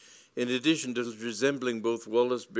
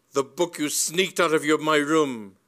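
loud, raised, indignant voice; angry accusation; 6 semitones higher, 8 dB louder than usual. A narrator's reading. Lady Bassett accusing Cyril of theft, loud and indignant. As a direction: accuse him with full indignation.

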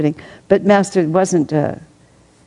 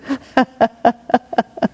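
high laughing burst; real laughter; 7 semitones higher than usual. Real, not acted. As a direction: laugh at the punchline. Reader laughing after punchline.

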